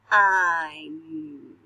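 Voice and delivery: Nine stretched out sound by sound; sounding out words. Shown as a demonstration. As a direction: sound out each piece. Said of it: "listen to these with me".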